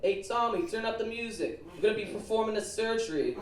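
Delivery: high-pitched call to the back room; imitated yell. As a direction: call out to a colleague, mock urgent. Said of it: "I hear him yell".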